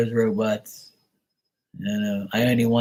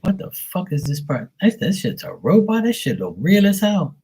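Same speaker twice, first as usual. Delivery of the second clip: shocked disbelief; 7 semitones higher than usual. Retold or imitated. Shocked at realistic robot.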